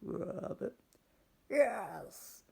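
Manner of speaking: the witch shrieking her yes; excited high witch welcome; witch's cry. Acted. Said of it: "cried the witch".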